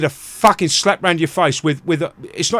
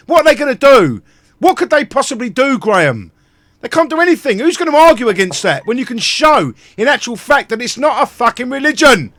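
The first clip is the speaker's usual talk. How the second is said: louder, higher angry ranting; raised voice, furious at the courts; heated rant; 5 semitones higher, 4 dB louder than usual. Real, not acted. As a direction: hammer the point, building fury.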